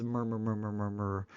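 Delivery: low, soft, run-together "murmur"; imitated brook. Shown as a demonstration. Lecturer shows how a brook got named, imitated babbling. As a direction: burble "murmur" over and over like running water. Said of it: "or a brook as".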